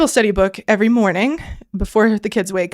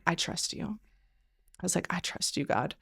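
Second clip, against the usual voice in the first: hushed surrender; 2 semitones lower, 14 dB quieter than usual. Retold or imitated. Quiet surrender in prayer.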